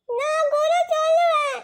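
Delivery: put-on high child retort; cheeky kid. Child answers the teacher back. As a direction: talk back like a bratty girl.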